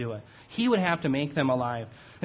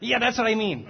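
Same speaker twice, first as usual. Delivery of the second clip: usual speaking voice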